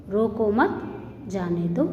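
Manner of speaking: "रोको मत" as one phrase, pause, then "जाने दो"; pause after मत. Shown as a demonstration. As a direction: break the sentence right after "मत". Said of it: "इसको इस तरह से पढ़ेंगे". ("मत" के बाद रुककर "जाने दो")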